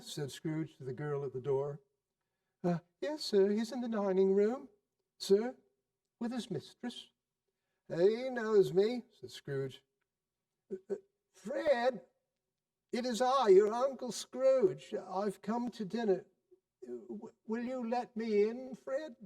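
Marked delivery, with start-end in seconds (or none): 2.6-7.1 put-on higher, timid young maid; a hint of a shy girl at the door; lightly voiced servant girl. A narrator's reading. 7.9-19.1 put-on higher, hesitant old uncle asking to come in; lightly voiced, timid uncle at the door; nervous old Scrooge. A narrator's reading.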